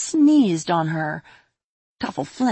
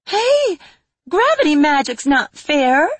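very high, louder indignant cry; loud protest; 10 semitones higher, 6 dB louder, slower than usual. Acted. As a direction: yell it out indignantly. Pinned fairy protests.

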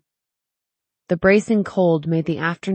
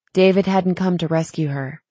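ordinary delivery

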